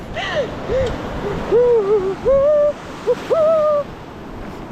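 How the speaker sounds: shrieking laugh. Real, not acted. In cold water.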